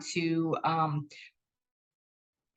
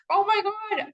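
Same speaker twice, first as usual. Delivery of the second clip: a sudden, much higher "Oh my God" as it dawns on her; shocked realization. Retold or imitated. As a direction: blurt it out as the mistake hits you. Realizes she left water running.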